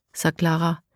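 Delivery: ordinary delivery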